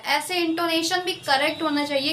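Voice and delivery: usual speaking voice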